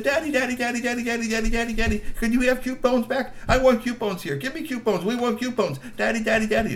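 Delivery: high, whiny pleading for daddy; begging child. Acted. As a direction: nag daddy insistently. Girl begs father.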